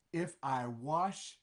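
ordinary delivery

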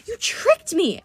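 high, outraged yell; indignant yell. A narrator's reading. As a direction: burst out in high-pitched accusation. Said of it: "I yelled".

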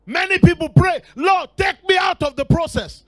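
loud, high preaching cry; fiery preaching. Acted. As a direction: preach it out to the whole church. Preacher at full force.

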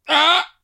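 abrupt high, loud "ah"; loud high-pitched mock outburst; imitated heckler noise. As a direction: mimic a guy blurting out a random sound. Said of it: "Like, just makes a".

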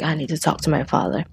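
plain reading voice

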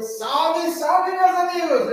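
high, loud shout to the chat; bright shouted welcome to viewers; excited greeting. Real, not acted. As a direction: call out the hello with joy.

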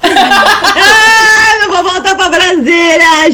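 hammed-up wail, starting high; mock crying. Drunk woman searching for her wallet, mock crying. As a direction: wail it like a drunk sobber, half laughing. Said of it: "chorando assim".

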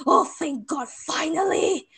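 relieved. Retold or imitated. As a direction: breathe it out like a weight is gone. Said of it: "and I was just like".

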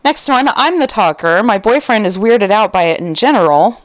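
plain reading voice

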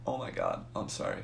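very soft, low, cowed apology; meek apology. Retold or imitated. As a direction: shrink into a hushed apology. Cowed after guard's threat.

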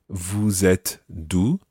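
slow, careful French phrase; deliberate classroom French; slow teaching pace. Shown as a demonstration. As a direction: spell it out slowly for learners. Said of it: "Now watch that".

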